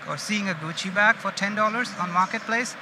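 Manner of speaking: ordinary delivery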